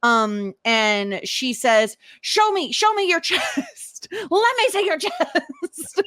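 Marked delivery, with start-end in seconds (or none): 2.2-6.0 mock Felicity demand. Retelling Felicity demanding, laughing.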